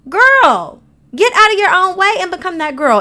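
loud, high-pitched telling-off; exasperated scolding. Acted. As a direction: fire back at her, high and loud. Scolding reply to girls' messages.

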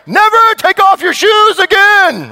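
dad losing it at the kids, high mock outrage; fed-up dad. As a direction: snap it like a dad who has had it. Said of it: "you say the stupidest things".

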